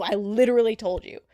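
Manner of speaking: normal talking voice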